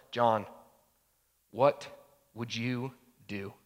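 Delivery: low question, broken by long pauses; sober self-questioning. Acted. Pastor asks himself inside the story, soberly. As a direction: put it gravely, word by word. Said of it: "with this, like, sobriety of the soul".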